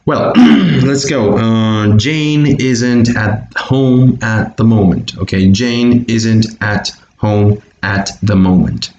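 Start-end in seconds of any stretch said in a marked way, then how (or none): 2.0-5.2 slow model sentence, words linked together; slow teacher model, words run together; slow linked reading. Shown as a demonstration. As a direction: read it slowly, joining each word to the next. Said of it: "would be the way we link".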